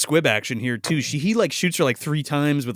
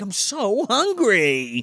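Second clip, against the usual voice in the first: slow, higher-pitched mock moan; hungry wife; 6 semitones higher, much slower than usual. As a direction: stretch it out like a famished wife insisting. Repeats wife's hungry complaint.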